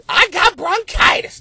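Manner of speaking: high shouted imitation of the interview lady; viral lady impression. Comic retelling the viral clip, high shouted impression. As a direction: belt it like the viral news lady. Quoting viral video woman.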